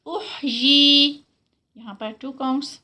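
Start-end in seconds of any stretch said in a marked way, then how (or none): 0.1-1.1 احیی chanted very slowly, higher and louder; احیی sung out slowly in tajweed; recited demo. Shown as a demonstration. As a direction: stretch احیی in Quranic recitation, vowel held two counts. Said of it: "یہ دونوں ہا کے ساتھ ہوگی".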